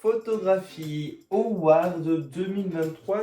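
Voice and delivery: very slow, drawn-out announcement; laboriously long name. Pretend. Host mocks an overlong award name, dragging it out. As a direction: stretch out the endless title. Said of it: "le nom est beaucoup trop long".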